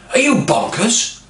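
high, loud, slow mock outrage; aggressive retort. Aggressive patient scoffs at the receptionist, hammed-up outrage. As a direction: fling it back at him, scandalised and slow. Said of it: "She was very aggressive".

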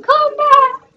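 high, drawn-out mock plea; loud, high, hammed-up distant call; Titanic plea. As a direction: imitate a film heroine calling out. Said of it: "it's like Titanic, where it's like".

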